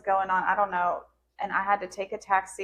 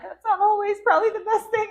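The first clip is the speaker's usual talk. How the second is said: higher, laughing through the words; wry laughing protest; 12 semitones higher, 5 dB louder than usual. Real, not acted. Laughing about being handed another baby.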